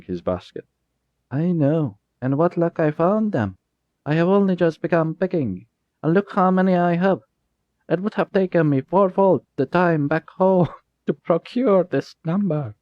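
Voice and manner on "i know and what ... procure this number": put-on high, eager boy's voice; an eager boy showing off his berries; proud boy. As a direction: brandish your pride like a young lad. Jasper voiced, proud young boy.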